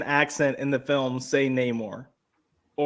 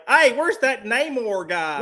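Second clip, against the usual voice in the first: slow, higher mock hillbilly voice; 8 semitones higher, 3 dB louder, slower than usual. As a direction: play a clueless hillbilly asking around. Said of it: "some hillbilly is gonna come up and be like".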